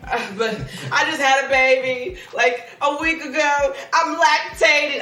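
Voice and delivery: laughing. Real, not acted. Laughing retelling about baby.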